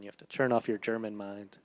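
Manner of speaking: ordinary talk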